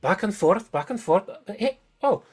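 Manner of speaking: high, excited discoverer; excited NPC voice. Acted. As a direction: voice her fumbling with the controls, delighted. GM voices NPC Adelina discovering controls.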